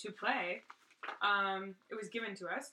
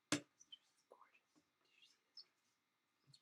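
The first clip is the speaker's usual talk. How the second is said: whispered admiration; 12 dB quieter, slower than usual. Real, not acted. Admiring the dress card.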